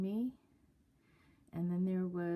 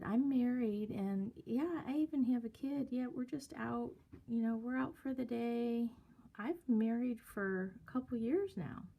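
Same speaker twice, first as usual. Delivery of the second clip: lightly mimicked sing-song, pitched up; sing-songy girl; 4 semitones higher than usual. Young woman telling older man she is married, mimicked sing-songy. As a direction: imitate a friendly, chatty young girl in singsong. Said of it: "talk in a real sing-songy voice".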